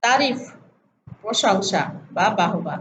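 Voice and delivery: ordinary delivery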